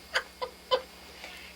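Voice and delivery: airy unvoiced laughter; breathy laugh. Real, not acted. Laughing after punchline.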